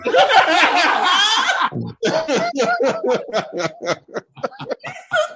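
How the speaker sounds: high, loud burst of laughter; loud shrieking laugh. Real, not acted. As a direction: howl with laughter at the joke. Shouting laugh at Diane Sawyer joke.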